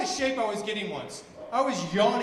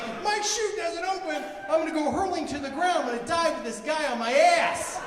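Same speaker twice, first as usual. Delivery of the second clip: high, louder freaking-out babble; hammed-up skydiving fear; mock panic; 7 semitones higher, 3 dB louder than usual. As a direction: fret like you're about to jump. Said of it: "I'm like".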